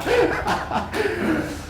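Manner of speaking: a burst of high laughter trailing down. Real, not acted. Amused by the thought of the neighbors, high laugh. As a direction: let a real laugh spill out. Laughing at own loud crying.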